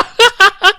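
loud, high put-on cackle; high boastful laugh. Character laughs after boasting, high loud cackle.

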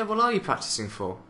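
usual speaking voice

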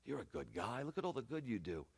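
very soft, low coaxing; hushed tempter's wheedling; cajoling enemy. Acted. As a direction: sweet-talk them quietly into giving in. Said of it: "The enemy's always cajoling".

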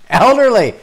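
high, loud cry of mock offence; outraged shout. Comic protests the joke writer's word, outraged shout. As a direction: blurt it out, loudly affronted.

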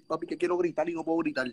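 usual speaking voice

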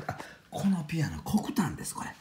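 hushed whisper so as not to wake the neighbors; excited midnight whisper over the piano. Real, not acted. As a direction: whisper it late at night. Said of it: "小さな声で喋らせてもらいました".

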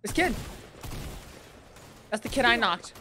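excited shout. Real, not acted. Spotting enemy, yelling.

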